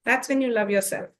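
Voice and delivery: normal talking voice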